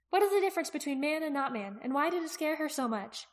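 put-on high girl's voice, posing a puzzle; questioning young Aki. Aki listing open questions for Jaqen, high questioning voice.

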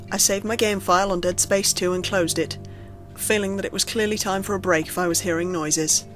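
plain reading voice

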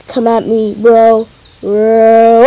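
normal talking voice

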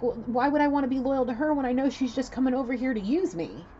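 high, loud, aggrieved question; heated, worked-up protest; indignant retort. Retold or imitated. Channels man's indignant question.

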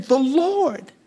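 high, slow, exultant proclamation; David proclaiming. Retold or imitated. David declaring to the congregation, high and exultant. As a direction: lift each word up slowly. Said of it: "And David is saying".

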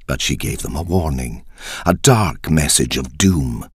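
ordinary delivery, nothing marked

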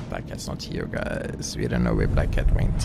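very low, quiet playful creak; low creaky aside. Pretend. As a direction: drop right down into a low croak. Playful aside about missing character.

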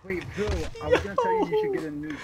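high, loud excited outburst; excited shout. Real, not acted. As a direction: blurt it out, all worked up.

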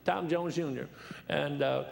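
usual speaking voice

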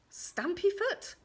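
slow, very high rising question; tentative guess. Acted. Queen tries a silly name, questioning. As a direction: pitch it right up as a guess.

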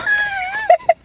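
squealing laugh. Real, not acted. Girl laughs off accusation.

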